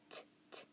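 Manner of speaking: "t" as a soft voiceless tap, no vowel; two separate soft "t" clicks; sounding out. Shown as a demonstration. Teacher sounding out little, bare "t" sounds. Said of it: "try to sound out the word".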